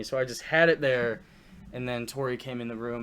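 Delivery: ordinary delivery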